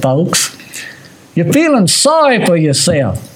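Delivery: usual speaking voice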